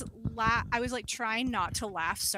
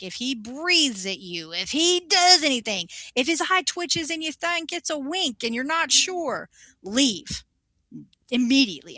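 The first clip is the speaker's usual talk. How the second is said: stern protective warning; 3 semitones higher than usual. Retold or imitated. Manager warning the young waxer about the client: stern, rising. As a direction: warn her like an overprotective boss. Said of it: "she was like".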